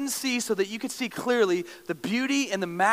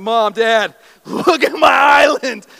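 louder, slower, laughing with pride; proud kid showing off; 9 dB louder, slower than usual. Retold or imitated. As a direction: show your parents your project. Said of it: "I was like".

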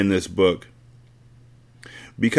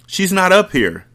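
high, worried call; worried scream; 10 semitones higher than usual. A narrator's reading. Zaire calling down the stairs in worry, screaming. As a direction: shout down in rising panic. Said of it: "he screamed".

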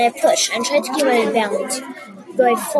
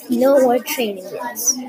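No More Training Wheels said slowly and deliberately; book title; slower than usual. Shown as a demonstration. Author announcing the book's title, slow and deliberate. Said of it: "titling my book".

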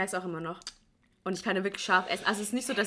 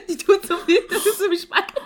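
laughing; 12 semitones higher, 11 dB louder than usual. Real, not acted. Laughs at the sour candy.

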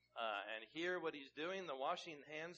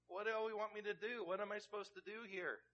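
pleading Pilate; 8 semitones higher, 3 dB louder than usual. Retold or imitated. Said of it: "I think Pilate really is asking".

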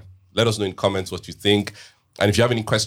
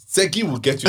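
laughing; 8 semitones higher than usual. Retold or imitated. Teasing child's line.

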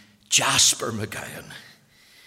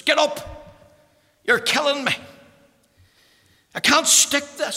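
high, raised voice waking her husband; high-pitched, agitated outburst; distressed wife; 13 semitones higher, 8 dB louder than usual. Retold or imitated. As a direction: act out an urgent, desperate demand.

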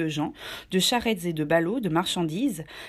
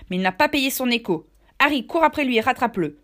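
louder, commanding sailor voice; gruff Silver; 5 semitones higher, 5 dB louder than usual. Acted. Tavern keeper ordering a chase, loud and commanding. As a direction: send a man after the thief.

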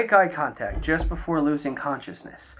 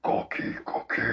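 Cookie Monster voice; 8 dB quieter than usual. Retold or imitated. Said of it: "the Cookie Monster".

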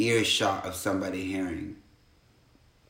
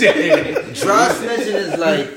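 laughing; 9 semitones higher, 6 dB louder, much slower than usual. Real, not acted. Laughing, slow.

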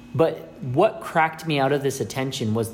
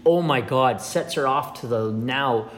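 normal talking voice

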